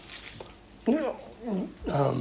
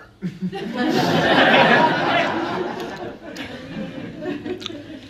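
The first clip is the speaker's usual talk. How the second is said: high burst of laughter; 13 semitones higher than usual. Real, not acted. Speaker to audience, laughing at own story. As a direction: crack up at the punchline.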